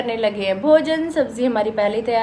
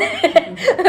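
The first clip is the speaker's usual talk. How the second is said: giggle; 6 semitones higher than usual. Real, not acted. Laughs at herself.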